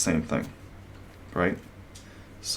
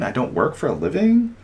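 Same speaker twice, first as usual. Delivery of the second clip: mocking lilt; 6 semitones higher than usual. Shown as a demonstration. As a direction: drift upward like a bored rich person. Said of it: "You can't do the lilte".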